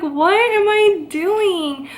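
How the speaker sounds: high, slow, drawn-out panicked wail; panicked disbelief. Retold or imitated. California girl panicking over the hurricane, a high drawn-out wail. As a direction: stretch it out high in mock panic.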